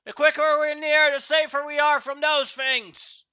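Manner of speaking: high, full-volume panicked shouting; screaming crowd. Acted. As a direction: scream it out as the terrified people aboard. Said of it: "like, people are screaming".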